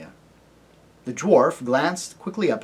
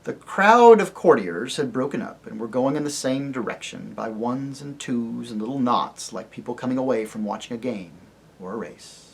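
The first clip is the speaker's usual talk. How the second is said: ordinary delivery, nothing marked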